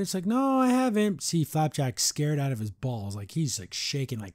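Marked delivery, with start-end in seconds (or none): 0.3-1.2 high-pitched quoted denial; voiced character reply. Answering whether it's been found, high mock reply.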